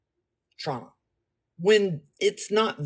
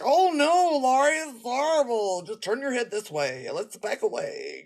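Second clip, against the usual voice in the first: put-on horror; 6 semitones higher than usual. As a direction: play the horrified friend shielding her from the sight. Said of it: "So I mathed".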